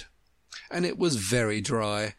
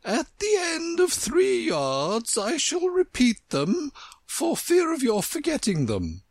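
imperious Queen, instructing. A narrator's reading. Queen giving Alice her directions, high imperious voice. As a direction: proclaim each step high and deliberately.

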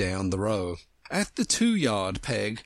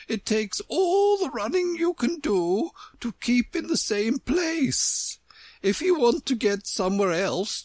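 imperious Queen, explaining; 10 semitones higher than usual. A narrator's reading. Queen explaining her country to Alice, high imperious voice. Queen's speech in story narration.

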